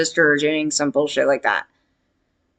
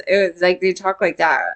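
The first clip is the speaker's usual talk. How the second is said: ordinary talk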